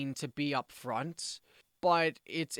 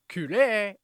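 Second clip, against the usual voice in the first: loud, high, brisk demo of a command; 5 semitones higher, 9 dB louder than usual. Shown as a demonstration. Teacher showing the informal Japanese command, brisk. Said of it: "That's a very brisk way of talking".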